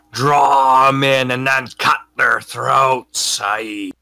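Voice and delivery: slow, drawn-out pirate relish; pirate crewman. Acted. Crewman backs the captain's ambush plan, slow pirate voice. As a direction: savor it like a scheming sea dog.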